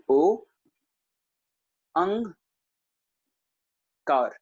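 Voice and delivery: ओ, अंग, कार each said apart with pauses; syllable by syllable. Shown as a demonstration. Teacher spelling out the sounds, separated syllables. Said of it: "पहले सबसे पहले ओ उच्चारण करना है".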